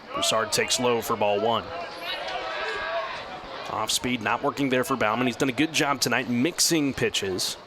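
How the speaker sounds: ordinary talk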